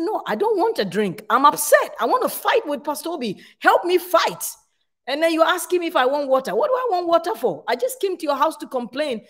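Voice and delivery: high, heated protest, brushing off the offered drink; mock angry complaint. Wife to pastor offering a drink, high indignant protest. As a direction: play up how worked up she was. Retold fight, wanting to complain.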